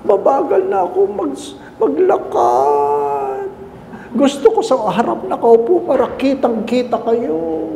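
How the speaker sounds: a frail grandmother making excuses; old lady voice. Retold or imitated. Old lady explains to priest.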